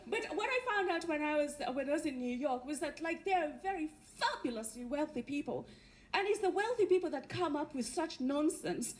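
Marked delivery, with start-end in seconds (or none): none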